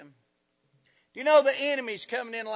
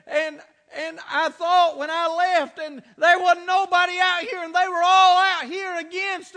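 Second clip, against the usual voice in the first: impassioned retelling; 6 semitones higher, 6 dB louder than usual. Retold or imitated. Retells woman's impassioned complaint.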